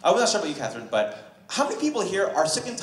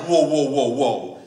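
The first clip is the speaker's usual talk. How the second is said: shocked; 3 semitones lower, 6 dB louder, slower than usual. Retold or imitated. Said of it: "And I was shocked".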